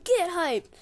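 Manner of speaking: high, loud hype yell; retold scream. Player retelling his game-time cheer, screaming. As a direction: belt out a high rallying cry. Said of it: "You know, I'm screaming".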